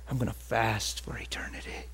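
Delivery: hushed, breathy whisper to himself; excited whisper. Retold or imitated. Preacher whispering his fasting vow to himself. Pumped self-talk while fasting.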